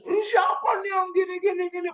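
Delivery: high shout that fades by the end; a coworker shouting blame across the room; mock shout. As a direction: bark out an accusation, then trail off. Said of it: "tiba-tiba teriak".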